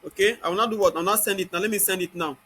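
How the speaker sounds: ordinary delivery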